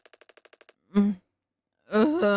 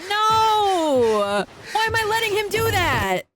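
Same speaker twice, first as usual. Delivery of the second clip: horrified cry; 11 semitones higher, slower than usual. Real, not acted. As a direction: cry out against what's happening. Horrified as character acts.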